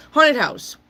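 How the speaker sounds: high, loud card reveal; excited announcement. Acted. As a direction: hype it like a game show. Announcing card again, soundboard joke.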